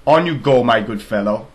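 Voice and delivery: loud, kindly royal dismissal; grand king. Acted. King dismisses the relieved soldier, loud. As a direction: wave him off with a booming voice.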